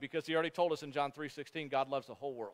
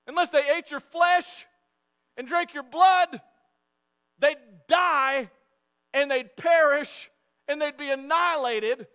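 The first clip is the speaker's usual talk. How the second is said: high, loud, slow outraged challenge; incredulous deacon; 9 semitones higher, 9 dB louder, slower than usual. Pretend. Deacon board protesting to Jesus, loud incredulous challenge.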